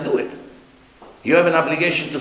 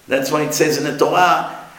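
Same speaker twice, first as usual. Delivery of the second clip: ordinary talk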